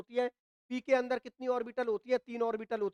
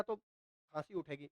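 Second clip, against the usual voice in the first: dry throat; 6 semitones lower, 9 dB quieter, slower than usual. Real, not acted. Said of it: "गला सूख गया मेरा".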